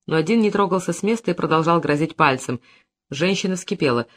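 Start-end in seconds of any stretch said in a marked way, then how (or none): none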